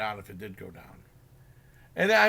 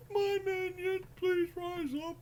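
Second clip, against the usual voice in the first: much higher, softer, slow mock vampire voice; hammed-up summoning of the minions; lame Dracula; 8 dB quieter, slower than usual. Lame Dracula calling his zombies, high, soft mock voice. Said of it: "he talked like".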